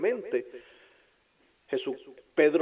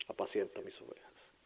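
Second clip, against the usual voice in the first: whispered reply; 4 semitones lower, 10 dB quieter than usual. Acted.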